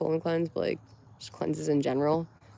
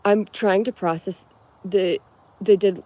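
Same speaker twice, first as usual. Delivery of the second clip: ordinary talk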